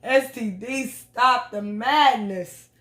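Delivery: slow, drawn-out hell no; mock outrage. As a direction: stretch every word in disbelief. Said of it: "I was like".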